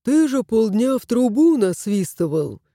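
usual speaking voice

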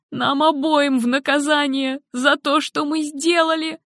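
high, bitter and hopeless lament; despairing mother. Acted. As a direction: spill out her despair, high and heavy. Helen despairing to husband.

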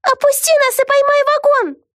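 very high, urgent cry; panicked plea. A narrator's reading. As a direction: call out urgently in a squeal. Vega shouting from falling wagon. (отчаянная высокая мольба)

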